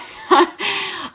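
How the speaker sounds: a high, choked laugh as tears come; teary laugh. Real, not acted. Streamer tearing up live, high choked laugh. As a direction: laugh through rising tears. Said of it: "I'm gonna start crying on this live".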